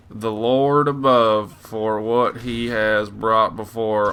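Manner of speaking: slow, drawn-out Southern preacher eulogy; southern preacher accent. Acted. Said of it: "act like a southern preacher man".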